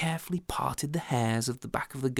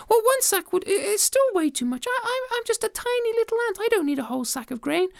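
very high, flustered refusal; too small for such a gift; squeaky modest ant; modest tiny ant; 4 dB louder than usual. Acted.

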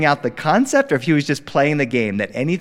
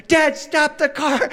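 put-on demanding toddler; 12 semitones higher, slower than usual.

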